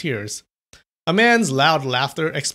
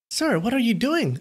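higher, puzzled woman; woman's voice; 10 semitones higher than usual. A narrator's reading. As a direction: ask as the maid. Woman's voice next room.

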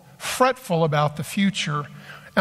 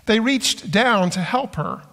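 ordinary talk

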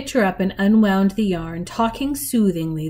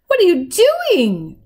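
high, loud cry of disbelief; alarmed cry; 10 semitones higher, 5 dB louder than usual. A narrator's reading. Girl finding Granny tearing up the couch, alarmed cry. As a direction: cry out at what you've just found. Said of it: "Lena cried".

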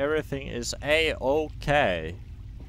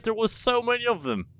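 usual speaking voice

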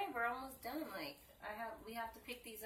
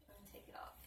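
whispering; 16 dB quieter, much faster than usual. Real, not acted. Quiet aside while removing clips.

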